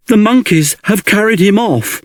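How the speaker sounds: high, alarmed gasp of a worried bear. A narrator's reading. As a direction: blurt it out in a breathless panic. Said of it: "gasped Baloo".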